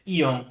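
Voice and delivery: "Ion" said with a nasal vowel. Shown as a demonstration. Teacher modelling the nasal vowel "Ion". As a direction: put "Ion" through the nose. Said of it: "perceba a vogal nasal".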